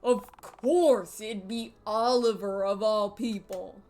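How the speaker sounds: mildly hammed-up New York grumble; New York accent. Gamer voicing the character in New York accent. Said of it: "what's my New York voice?".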